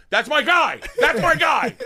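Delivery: high, loud rowdy hollering; cheering supporters. Retold or imitated. Dudes cheering the candidate after his speech, hollering. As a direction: whoop it like a fired-up crowd.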